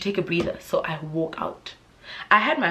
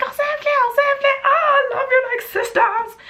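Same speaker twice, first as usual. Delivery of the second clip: much higher squealing fan voice; gushing girls; 6 dB louder than usual. Retold or imitated. Girls in the club toilet gushing over her, high squeal. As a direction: squeal like an adoring girl in the toilet. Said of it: "You're like".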